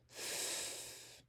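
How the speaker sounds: "f" as a soft voiceless hiss, no vowel; just breathe out a whispered "f"; letter sound f. Shown as a demonstration. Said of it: "F says".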